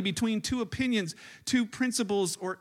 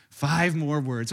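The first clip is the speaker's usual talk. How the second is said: dry dad; 7 semitones lower, much slower than usual. Retold or imitated. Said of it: "I'm like".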